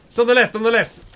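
high loud call-out; shouted directions. Real, not acted. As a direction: yell it across the field. Calling out directions again.

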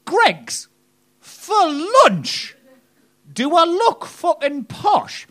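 high, stretched-out mock shriek; indignant hometown local. Local at station scoffing to a friend, shrill indignation. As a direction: squawk it in high-pitched disbelief. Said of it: "overheard someone at the train station".

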